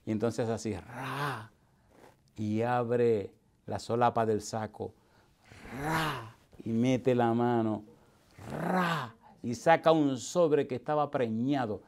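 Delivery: drawn-out "ra" sound effects, slow; slow suspense. Acted. Preacher acting out a man reaching into his coat, slowly. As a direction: build suspense like a slow reveal.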